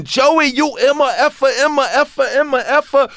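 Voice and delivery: high mock yell; hammed-up furious manager yelling; imitating a scream. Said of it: "Russell screaming".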